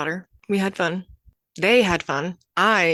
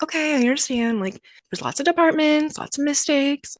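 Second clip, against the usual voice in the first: higher, sweeter understanding tone; understanding customer voice; 8 semitones higher than usual. Retold or imitated. A caller to customer service, imitating her usual patient understanding. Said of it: "being like".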